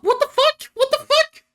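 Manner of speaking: very high, loud squeal of disbelief; hammed-up high-pitched bafflement; mock bewilderment. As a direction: shriek it in an absurdly high voice. Squeaky outburst at nonsensical clip.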